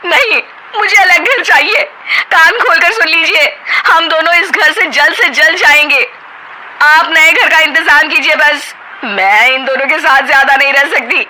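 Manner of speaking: high, loud and firm; wife demanding a separate home, insistent. Acted. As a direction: lay down the ultimatum.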